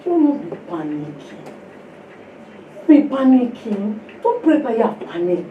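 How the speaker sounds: a hint of sleepy calm; calm, soft reply. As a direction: calmly correct the panicking woman. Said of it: "I said".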